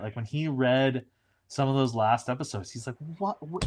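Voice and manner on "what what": pitched-up, baffled mock protest; imitating upset David. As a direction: play the indignant actor reading his script. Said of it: "David would get upset".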